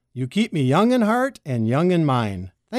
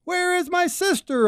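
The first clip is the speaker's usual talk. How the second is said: call out; 13 semitones higher than usual. A narrator's reading. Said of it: "I yelled to the birds".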